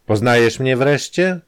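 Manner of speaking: lightly voiced blackmailer, pushy; Kramer's voice. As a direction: lean in like an old accomplice.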